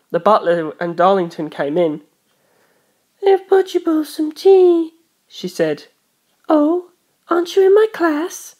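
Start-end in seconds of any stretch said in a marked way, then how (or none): 3.3-4.9 put-on high, slow haughty girl; a young aristocrat girl offering tea. A narrator's reading. Darlington voiced by narrator. 6.5-8.5 put-on high, slow haughty girl; a young aristocrat girl offering tea. A narrator's reading. Darlington voiced by narrator.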